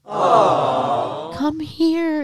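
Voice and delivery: drawn-out emotional wail, rising high; mock crying call for a hug; imitated tearful father. Father on knees calls daughter over, imitated crying. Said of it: "drops to his knees and starts crying".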